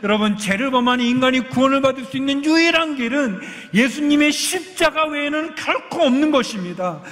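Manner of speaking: full-force preaching. Acted. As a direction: preach it out to the congregation. Preaching climax of sermon.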